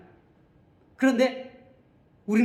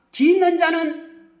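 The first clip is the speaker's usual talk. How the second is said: high, ringing scriptural call; loud proclamation; 7 semitones higher, 6 dB louder than usual. Acted. Preacher summoning all who have ears, loud proclamation.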